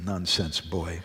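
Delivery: low, soft grumble of dismissal; understated acted irritation; a little annoyed. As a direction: dismiss the boy under your breath. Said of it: "got a little annoyed".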